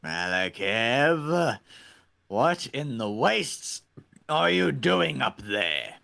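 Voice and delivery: lightly voiced zealot preacher; Redeemer voice. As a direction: bellow like a fanatic warlord. Plays the Redeemer character.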